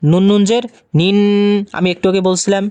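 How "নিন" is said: "নিন" with the final n hummed long; sustained nasal ending on "নিন"; ghunnah nasal hold. Shown as a demonstration. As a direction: let the n of "নিন" resonate in the nose. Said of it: "নুনের উপর তাসদিদ থাকলে গুণা".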